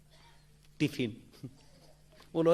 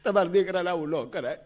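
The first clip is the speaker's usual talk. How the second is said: crying wife; 8 semitones higher than usual. Acted. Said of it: "રડતી રડતી".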